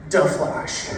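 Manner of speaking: "Da Flash" with a hard D sound and a laugh. Shown as a demonstration. Comic movie-title reveal, "Da Flash" through a laugh. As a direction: say "Da" in place of "the". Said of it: "his name is".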